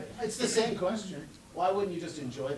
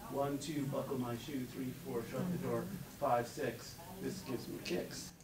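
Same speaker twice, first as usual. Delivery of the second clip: soft, sing-song counting rhyme; soft nursery chant; nursery rhyme recital; 3 semitones lower, 7 dB quieter than usual. Acted. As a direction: chant it softly like a child.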